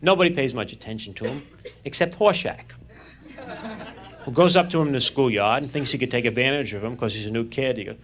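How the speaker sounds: ordinary talk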